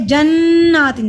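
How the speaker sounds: जन्नातिन with a long nasal hum on the doubled n; slow, loud recitation, n held in the nose; ghunnah on noon. Shown as a demonstration. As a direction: hold the नून of जन्नातिन through the nose. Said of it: "नून पे गुन्ना होगा".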